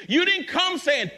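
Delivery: high, loud preaching cry; preaching shout. Real, not acted. Preacher scolds the congregation with a loud cry. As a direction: ring it out from the pulpit.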